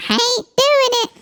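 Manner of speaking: very high, silly refusal; filter lady impression. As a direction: squeal it like a cartoonish voice filter. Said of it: "this sounds like that lady".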